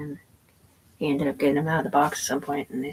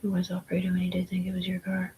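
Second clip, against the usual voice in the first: whisper; 3 semitones higher, 5 dB quieter than usual. Real, not acted. Whispered aside about Alfredo.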